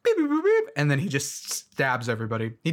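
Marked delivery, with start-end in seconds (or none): 0.0-0.6 high, loud chirpy robot beeping; robot beeps. Retold or imitated. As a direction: beep it out like a cartoon robot. Imitating robot noises.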